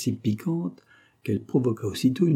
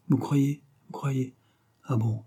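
plain reading voice

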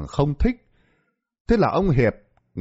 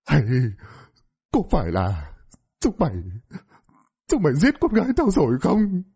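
rising to a high anguished cry; father's desperate high shout; anguished accusation; 4 semitones higher than usual. A narrator's reading. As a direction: accuse them of killing his daughter. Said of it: "ông Lãm gào lên".

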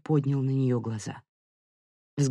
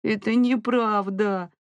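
put-on slow, high frightened child's whine; plaintive, drawn-out high child voice; whimpering; 7 semitones higher, slower than usual. A narrator's reading. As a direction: whimper it like a scared little boy. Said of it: "захныкал Мило, испуганный поведением матери".

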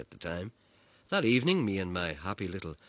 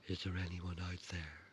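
hushed seance question; 9 dB quieter than usual. Retold or imitated. Mother calls spirits at seance.

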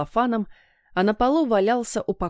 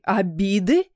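incredulous rising repeat; boiling over; 3 dB louder, slower than usual. A narrator's reading. Father reacting to talk of old grudges, boiling over. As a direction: throw it back angrily, rising. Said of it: "вскипел папа".

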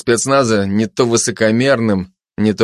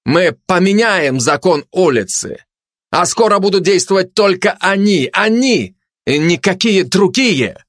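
raised-pitch bombast; pompous proclamation; 8 semitones higher than usual. Acted. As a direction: proclaim it with lofty pathos. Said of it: "с пафосом".

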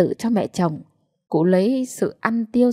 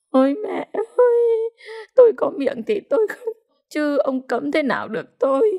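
defiant wailing; 8 semitones higher than usual. A narrator's reading. Widow answers the official's ban, wailing defiantly. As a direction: wail back through the tears.

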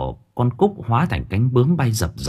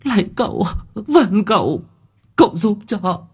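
much higher, anxious pleading; urgent begging; 11 semitones higher than usual. A narrator's reading. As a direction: beg in a rush of worry. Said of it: "Bà Thu sốt sắng".

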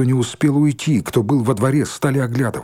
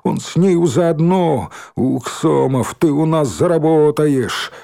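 slow, raised, crowing threat; jeering accusation; 4 semitones higher, 3 dB louder, slower than usual. Acted. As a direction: drag the words out tauntingly, pitched up. Bully's gleeful threat.

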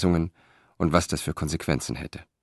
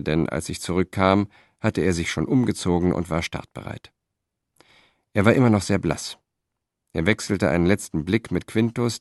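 plain reading voice

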